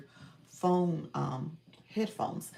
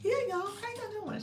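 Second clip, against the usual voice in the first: excited greeting. Retold or imitated. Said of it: "She was like".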